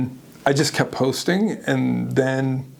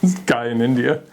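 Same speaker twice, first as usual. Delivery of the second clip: ordinary talk, nothing marked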